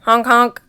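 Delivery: loud honking goose call. Acted. Goosey Lucy calling out to her friends, loud honks. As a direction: honk like a goose. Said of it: "exclaimed Goosey Lucy".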